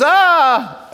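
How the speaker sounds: high, loud mock shriek of alarm; wife's hammed-up shriek at her phone; exaggerated gasp. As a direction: overplay her startled cry. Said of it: "I'm exaggerating it".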